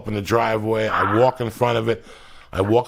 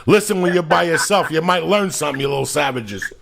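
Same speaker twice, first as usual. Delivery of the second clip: podcast promo; 4 semitones higher than usual. Acted. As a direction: hype the show like a jingle. Promo plug over music.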